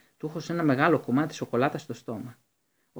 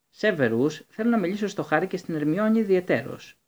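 curt; 6 semitones higher, 4 dB louder than usual. A narrator's reading. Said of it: "επανέλαβε κοφτά ο Ντάμπλντορ".

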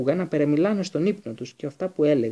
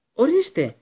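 high, loud shriek; 10 semitones higher, 7 dB louder, slower than usual. A narrator's reading. Pettigrew squealing, pointing at Hermione, shrieking. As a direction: shriek it in triumph. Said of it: "τσίριξε ο Πέντιγκρου".

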